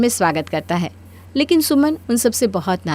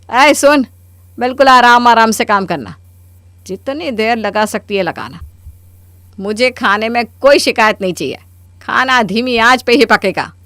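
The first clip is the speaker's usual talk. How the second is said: louder, bossy commands to a servant; bossy orders; 4 dB louder than usual. Acted. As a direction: order the maid around. Mother bossily instructs the maid. (नौकरानी को रौब से हुक्म देते हुए)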